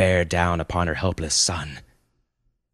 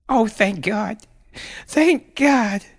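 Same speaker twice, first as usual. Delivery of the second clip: high, breaking-out relief, growing louder; overwhelmed relief; 4 dB louder, slower than usual. Acted. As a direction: let relief flood out in a high voice. Mother relieved son is alive.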